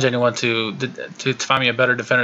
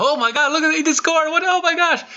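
high-pitched mock yell of disbelief; re-enacted excited yelling. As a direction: squeal it like a fan reliving the goal. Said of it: "I was yelling".

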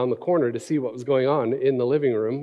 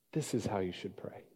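whisper; 5 semitones lower, 15 dB quieter than usual. Acted. Whispered line.

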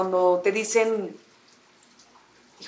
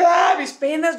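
hammed-up wail of sorrow, higher and louder; mock lament; 7 semitones higher, 4 dB louder, slower than usual. Mimicking arriving at work in tears, hammed-up lament. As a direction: fake a melodramatic sob over your woes. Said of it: "llego llorando".

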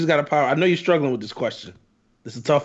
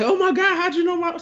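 put-on high, gushing girlfriend voice; delighted girlfriend. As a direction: squeal it like she's thrilled.

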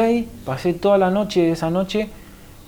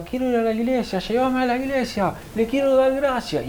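high, insistent quoted plea; retold resonating inner voice; 9 semitones higher than usual. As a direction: voice an inner urge, pitched up. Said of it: "una voz que me resonaba en todo". (voz interior imitada, aguda y suplicante)

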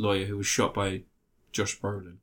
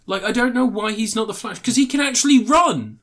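exasperated outburst; 10 semitones higher, 4 dB louder than usual. Real, not acted. Co-host ranting about who should be Flash, exasperated outburst. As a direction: pitch leaps high, building louder on the last words.

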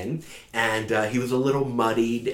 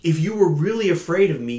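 sharp, raised, rushed outburst; a king's angry retort, fast and loud; furious king; 7 semitones higher, 6 dB louder, faster than usual. Acted. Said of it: "the king was furious at this".

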